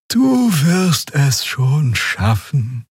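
very slow, drowsy encouragement; lazy, half-asleep reassurance; sluggish. A narrator's reading. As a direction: drag it out lazily. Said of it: "sagte Xia träge".

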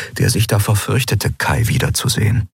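plain reading voice

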